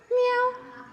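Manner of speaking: high cat meow. Retold or imitated. Teacher shows the class a cat's meow, high and squeaky. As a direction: mew like a little cat.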